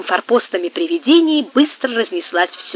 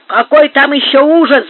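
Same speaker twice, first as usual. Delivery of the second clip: loud, higher scoffing outburst; dismissive shout; 5 semitones higher, 7 dB louder than usual. A narrator's reading. Marcos brushing off Gomes's horror, shouting. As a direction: cut off a friend's fear loudly. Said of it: "закричал Маркос".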